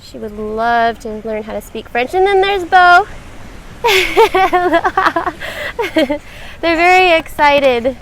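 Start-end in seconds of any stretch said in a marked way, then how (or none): 3.1-6.6 hearty burst of laughter; real delighted laugh. Real, not acted. Amused by excited children on stream.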